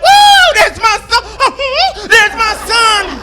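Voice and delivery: high, loud whoop cracking into a comic sob; hammed-up choked-up yelling; cheer breaking into crying. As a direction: start cheering, then let tears take the throat. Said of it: "It crept in my voice first".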